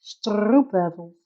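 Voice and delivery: stroopwafels slow with a trilled Dutch R; rolled R. Shown as a demonstration. Streamer showing chat the Dutch rolling R, slowly. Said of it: "Een rollende R".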